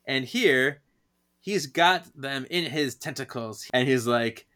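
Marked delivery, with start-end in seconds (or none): none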